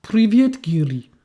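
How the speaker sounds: mock Russian, rising on Привет; a playful fake Russian accent to a friend; stupid fake Russian accent. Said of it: "making that stupid accent".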